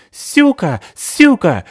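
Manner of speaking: high, loud shrieked curse with a lisp; shrieking accent. Acted. Сыкиль с ножом вылетает из угла, high shrill war cry. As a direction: launch at her screaming.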